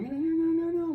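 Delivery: high-pitched whiny protest. Retold or imitated. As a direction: whine it like a fussy child. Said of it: "I was whiny".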